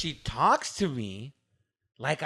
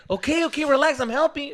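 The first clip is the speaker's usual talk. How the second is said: high-pitched imitation of partner; defensive placating; 10 semitones higher, 4 dB louder than usual. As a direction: back off in a raised-pitch voice. Acted partner answering in quarrel.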